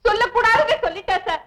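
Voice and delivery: high, whimpering pretend sob; fake crying. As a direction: complain through put-on tears. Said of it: "பிரமாதண்டி உன் நடிப்பு".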